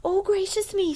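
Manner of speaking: put-on high, bright-eyed little girl. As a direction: play the sweet little girl.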